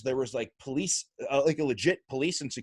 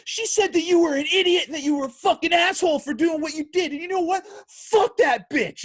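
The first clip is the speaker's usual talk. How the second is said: much higher, much louder mock rage; imitating an angry friend yelling about a woman; retold furious yell; 6 dB louder than usual. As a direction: shout it as the raging friend. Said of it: "he is just screaming".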